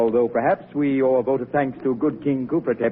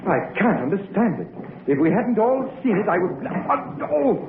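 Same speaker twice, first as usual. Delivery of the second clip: rising terror; 7 semitones higher than usual. Acted. Doctor terrified by the glowing mummy, panicked. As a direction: voice climbing into panic.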